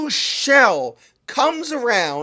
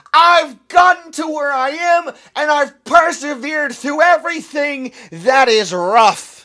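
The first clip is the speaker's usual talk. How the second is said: a full-throated, high and loud yell of self-belief; shouted declaration; 4 semitones higher, 7 dB louder than usual. Shown as a demonstration. Youth speaker yelling an example declaration for listeners to copy. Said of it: "I'm sorry to yell at you today".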